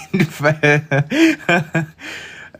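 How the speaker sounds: high, loud burst of laughter. Real, not acted. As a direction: crack up at the sentence. Reacts to trauma dumping.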